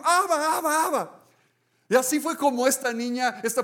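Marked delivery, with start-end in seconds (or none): 0.0-1.2 high excited cry, repeated; child's shout. Retold or imitated. Little girl runs to the rabbi, calling loud and high. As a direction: call him like a child running to him. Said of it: "salió corriendo y dijo".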